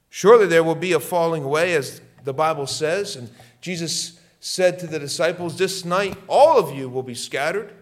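loud, fast warning; fiery preaching. Real, not acted. Preacher warning of a falling away, loud and urgent. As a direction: hurl it out with urgency.

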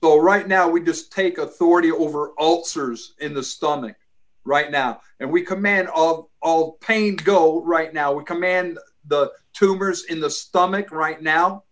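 commanding prayer voice; deliverance prayer. Acted. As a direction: rebuke spirits in prayer, firmly. Commanding prayer over ulcers.

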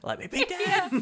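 high laughing wail; whiny wail. Pretend. Voicing revived fossil Pokemon.

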